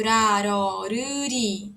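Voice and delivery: "ra, ro, ru, ri" read slowly, syllable by syllable; Korean syllables read aloud. Shown as a demonstration. Teacher sounding out Korean syllables for students, slow and clear. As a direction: sound out "ra, ro, ru, ri" one at a time.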